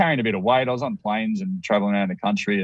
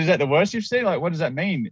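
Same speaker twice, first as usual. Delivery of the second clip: higher shocked disbelief; 8 semitones higher than usual. Retold or imitated. Retelling asking the doctor, stunned and incredulous. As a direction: pitch jumps high, incredulous question. Repeats question, more shocked.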